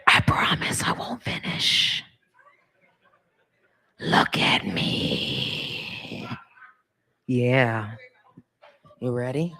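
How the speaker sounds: slow whisper, dropping very low; whispered promise. Pretend. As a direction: breathe it slowly, sinking deep. Whispered seduction.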